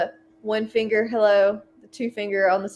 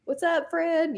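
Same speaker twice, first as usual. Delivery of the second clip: friendly passing greeting; 9 semitones higher, much slower than usual. Retold or imitated. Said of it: "doing the Sunday stroll".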